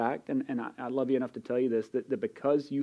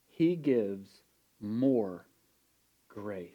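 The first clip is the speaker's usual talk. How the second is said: He gives... MORE... grace, a pause after each phrase; deliberate emphasis; 4 semitones lower, much slower than usual. Real, not acted. Preacher repeating the verse to the congregation, slow emphatic pauses.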